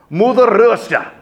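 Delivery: high, loud, grandiose "Mother Russia"; dramatic announcer flourish on "Mother Russia"; grand Mother Russia. Pretend. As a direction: boom it out like a proud anthem.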